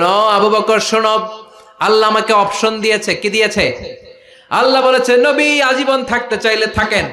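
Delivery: high, loud, chanted sermon delivery; impassioned preaching. Acted. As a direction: cry out the sacred story from the pulpit. Preaches the Prophet's announcement.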